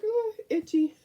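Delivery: high squeal of sympathy; high-pitched. Acted. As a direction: squeak it at the itchy dog. Reacting to dog scratching.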